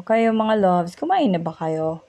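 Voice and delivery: ordinary delivery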